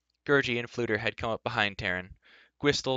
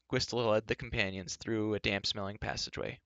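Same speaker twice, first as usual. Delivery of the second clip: ordinary delivery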